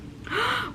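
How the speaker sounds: sudden startled gasp; sharp gasp. Real, not acted. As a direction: catch a sharp breath in surprise. Sudden realization about wrong lashes.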